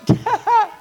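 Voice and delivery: high, loud burst of laughter; excited laugh. Real, not acted. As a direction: let a joyful laugh burst out.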